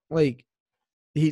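normal talking voice

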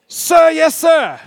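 loud, high drill shout; drill sergeant response. Shown as a demonstration. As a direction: bark it like a recruit. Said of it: "you need to say".

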